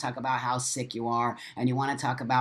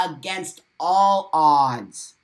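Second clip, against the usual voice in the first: slow and louder, stretching out "all"; loud proclamation; 7 dB louder, much slower than usual. Real, not acted. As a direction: proclaim "against all odds" like a hard-won victory. Defiant victory declaration.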